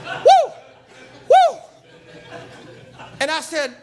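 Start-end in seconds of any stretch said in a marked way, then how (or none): 0.0-1.6 loud, high whooping cry. Real, not acted. Preacher whooping to the congregation, loud and high. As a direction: let out a big whoop of joy.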